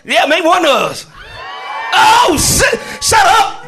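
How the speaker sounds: full-volume high shouting over a noisy class; yelling teacher. Shown as a demonstration. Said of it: "And the moment you yell".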